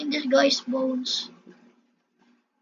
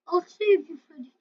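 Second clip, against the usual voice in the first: ordinary talk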